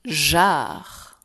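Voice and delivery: "jarre." loud and drawn out; dictated syllable. Shown as a demonstration. Teacher dictates the third syllable to pupils, loudly articulated. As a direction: stretch "jarre." firmly as one separate syllable. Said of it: "Troisième syllabe".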